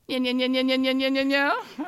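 loud, rapid nya-nya chatter; imitated shrew noise. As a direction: imitate a trapped shrew's insistent racket. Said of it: "I hear this".

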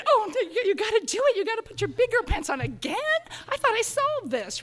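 high, loud, dismayed outburst; exasperated self-talk. Retold or imitated. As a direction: scold yourself in high-pitched disbelief. Acts out dismay at returning shrew.

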